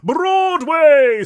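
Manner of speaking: high, very loud, outraged English correction; booming English ghost. A narrator's reading. Bald ghost correcting the Brooklyn ghost, booming English. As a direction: boom the word out with grand indignation. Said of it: "a deep, booming tenor with what might have been an English accent".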